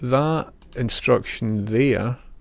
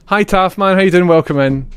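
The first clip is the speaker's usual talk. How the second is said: high, loud cheery hello; loud excited greeting; 7 semitones higher, 8 dB louder than usual. Real, not acted. Streamer calling out to a viewer, loud excited greeting.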